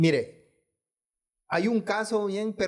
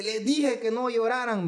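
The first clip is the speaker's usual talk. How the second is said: retelling the dead grandmother raising her voice, higher; a retold, half-raised call from the coffin; 6 semitones higher than usual. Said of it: "alzó la voz".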